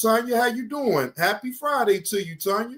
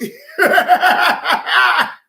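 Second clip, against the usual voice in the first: a real, loud laugh breaking out; loud burst of laughter; 9 semitones higher, 8 dB louder than usual. Real, not acted. Reacting to crash footage.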